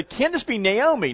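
high, delighted greeting; delighted surprise. Acted. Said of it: "her friends are delighted to see her".

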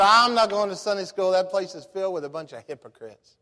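retold refusal, leaning hard on the opening words; indignant refusal. Acted refusal to an invitation.

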